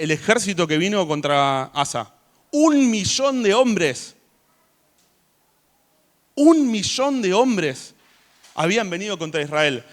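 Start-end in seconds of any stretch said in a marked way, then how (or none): 2.5-4.2 each word slow, high and loud; dramatic slow emphasis; emphatic. Acted. Stressing the size of the army. 6.3-7.9 each word slow, high and loud; dramatic slow emphasis; emphatic. Acted. Repeating the number for effect.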